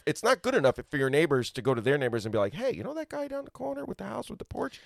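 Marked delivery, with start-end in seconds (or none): 2.5-4.8 neighborly tip. Acted. Acts out a recommending neighbor.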